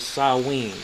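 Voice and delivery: Samhain said "sah-WEEN"; proper pronunciation. Shown as a demonstration. Reader explains the odd spelling, Samhain as "sah-WEEN". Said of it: "it's perfectly pronounced as".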